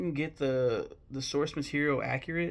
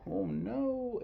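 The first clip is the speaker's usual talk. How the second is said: very high, emotional "oh no"; mock wail of dismay, pitched way up; retold dismay; 11 semitones higher than usual. Viewer reacting to Pooh's promise, retold high-pitched dismay. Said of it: "I was like".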